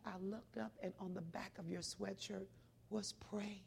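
quiet, intimate retelling; hushed. Retold or imitated. Old lady tells her story.

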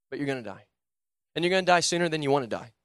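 usual speaking voice

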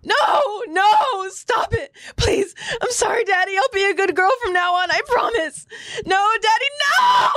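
yelling out. Acted. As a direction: sob and scream, begging Daddy. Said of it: "We hear Nanaba yelling out".

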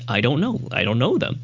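ordinary talk

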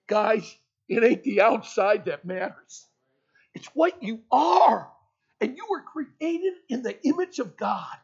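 loud, slow, full-force preaching. Acted. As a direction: drive the point home to the youth. Preaching climax to the audience.

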